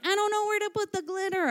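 high, rushed imitation of a tearful child; crying little girl. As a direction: squeak it out like a kid in a panic, mimicking. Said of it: "she starts crying 'cause she's like".

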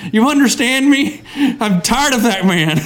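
ordinary talk, nothing marked

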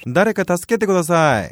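cry for help. Shown as a demonstration. Surrounded person calling for help in Japanese, loud cry. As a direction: call out to anyone who can help. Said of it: "곤란에 처했을 때".

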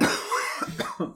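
hard unvoiced coughing; coughing fit. Real, not acted. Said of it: "Sorry for all the coughing".

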